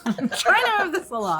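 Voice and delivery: high-pitched, laughing complaint; laughing protest. Real, not acted. Game master hurrying the table along, laughing high protest. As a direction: protest helplessly through laughter.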